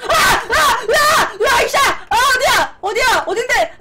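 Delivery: frantic high shouting; yelling in panic; panicking gamer. Real, not acted. Being shot at.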